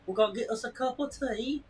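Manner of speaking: high-pitched mock British; British accent. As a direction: put on a playful British accent. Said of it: "now I sound like I'm from British".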